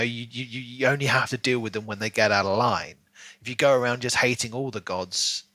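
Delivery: usual speaking voice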